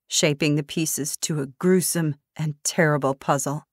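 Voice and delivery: plain reading voice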